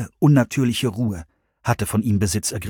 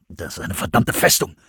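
much lower panting growl; panting Brian; 6 semitones lower than usual. A narrator's reading. Brian winded at the steel doors, low panting. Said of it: "keuchte Brian".

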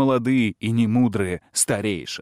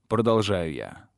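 usual speaking voice

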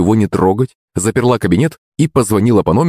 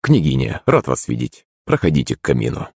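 lower, softer courteous voice; polite; 6 semitones lower, 4 dB quieter than usual. Acted. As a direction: greet her with smooth politeness. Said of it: "услышала вежливый голос".

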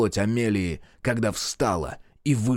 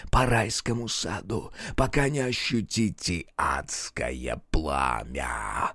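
низкий, медленный демонический голос; глумливый демон, растягивая слова; 3 semitones lower, 3 dB quieter, slower than usual. A narrator's reading. Демон говорит с людьми. (низкий зловещий голос демона)

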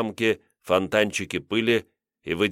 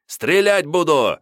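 high, loud, drawn-out warning; piercing shout; 8 semitones higher, 5 dB louder, slower than usual. A narrator's reading. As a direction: shout a threat after fleeing men. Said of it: "с пронзительными криками". (пронзительный протяжный крик-угроза)

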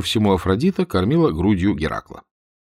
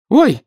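высокий громкий женский визг; взвизгни тонко; 7 dB louder than usual. A narrator's reading. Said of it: "взвизгнул тонкий женский голос". (испуганный женский взвизг)

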